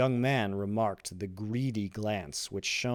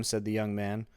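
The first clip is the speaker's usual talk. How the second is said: usual speaking voice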